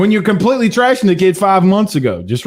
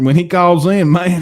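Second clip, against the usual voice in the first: ordinary talk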